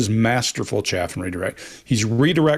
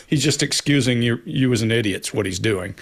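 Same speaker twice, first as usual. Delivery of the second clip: normal talking voice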